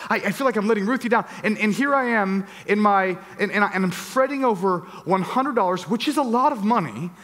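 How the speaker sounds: usual speaking voice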